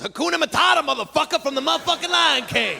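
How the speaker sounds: high, rising New York street holler; street guy yelling. Retold or imitated. Truck unloader hollering at his coworker, high rising street holler. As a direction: call it out to your buddy across the truck. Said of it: "One guy looks at the other guy and goes".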